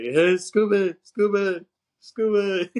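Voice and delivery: high, loud calling out; mock dog-calling. Busey pretending to look for his dog, calling out. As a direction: call out for a lost dog, putting it on.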